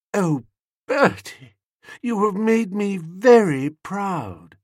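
high, slow, gushing sweetheart; touched young niece. A narrator's reading. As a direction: draw it out in a high, overcome voice. Niece feigns joy at a proposal.

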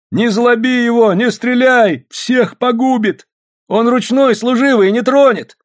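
high, insistent warning shouts; alarmed urging. A narrator's reading. Frightened crowd warning the soldier, high urgent shouts. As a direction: plead urgently at a high pitch. Crowd panics before the bear.

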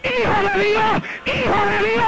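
preacher shouting his victory cry; triumphant shout. Retold or imitated. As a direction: ring it out in triumph. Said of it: "Y le grité".

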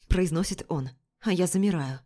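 ordinary delivery, nothing marked

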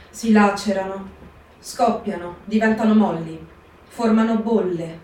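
ordinary talk, nothing marked

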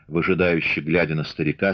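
ordinary delivery, nothing marked

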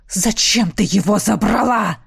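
rough roared accusation; wrathful warrior's growled question; angry roar. A narrator's reading. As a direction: roar it at the monster. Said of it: "проревел Родерик".

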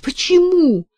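high, loud startled question; a sharp cry of alarm; mother crying out in alarm; exclaiming. A narrator's reading. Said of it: "воскликнула мать".

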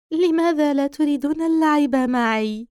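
through a vent. Acted. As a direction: coax like a haunted doll calling from a vent. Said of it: "تكلمت بنفس الصوت الذي سمعناه من فتحة التهوية".

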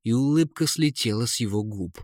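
plain reading voice

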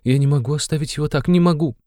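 high, imploring mother's voice climbing at the end; insistent. A narrator's reading. Mother cannot leave her son, insistent pleading. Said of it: "настаивала Пульхерия Александровна".

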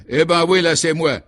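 high, loud outburst; exploding confession. A narrator's reading. Cornered suspect cracks before the commissioner, exploding in confession. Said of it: "Et soudain l'homme éclata".

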